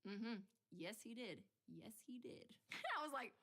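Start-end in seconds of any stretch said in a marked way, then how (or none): 1.7-2.5 hushed conspiratorial whisper. Real, not acted. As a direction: breathe it like a secret. Whispered repeat of confirmation.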